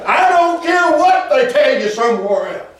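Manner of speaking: high, relentless preacher's crescendo; full-force preaching. Real, not acted.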